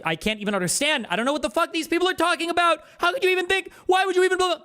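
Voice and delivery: worked-up, very high-pitched rant; exasperated rant climbing into a high pitch; upset high voice. Shown as a demonstration. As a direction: voice shoots way up in outrage. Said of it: "when I tend to get more upset".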